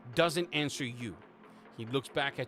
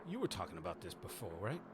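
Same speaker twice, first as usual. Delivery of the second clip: hushed aside; 5 semitones lower, 10 dB quieter, faster than usual. Acted. GM voices quiet question.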